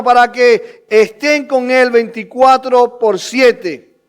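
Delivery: ringing sermon questions; preaching at full force. Real, not acted. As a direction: preach it loud to the congregation.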